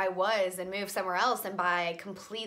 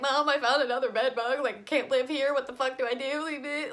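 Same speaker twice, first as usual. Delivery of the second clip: high, loud, tearful panic retold; panicked crying; 5 semitones higher, 4 dB louder than usual. Calling mom about another bed bug, panicked tearful plea. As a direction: voice cracking with teary alarm as she relives it. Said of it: "I'm crying".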